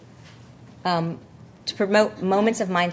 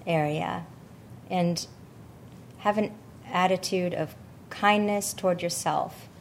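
guided meditation; 6 dB quieter than usual. Acted. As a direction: gently guide them into calm, unhurried. Leads a loving kindness meditation.

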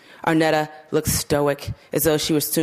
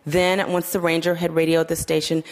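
plain reading voice

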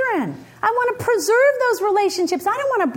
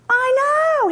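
high, emphatic agreement; earnest son; 7 semitones higher than usual. Retold or imitated. Son answering his disappointed mother, high and emphatic. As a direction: imitate a kid eagerly owning up.

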